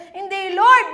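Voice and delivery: loud protesting outburst; protesting cry. Retold or imitated. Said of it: "dami kong murmur". (malakas na pagtutol)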